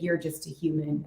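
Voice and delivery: low, quiet snooty cat. Retold or imitated. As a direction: look down on the human, voiced low and soft. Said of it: "that's how he talks to me".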